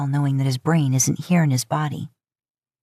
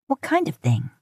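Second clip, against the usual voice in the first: higher, sharp accusing question; suspicious Lisa; 8 semitones higher than usual. Acted. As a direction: pin him down, higher pitch.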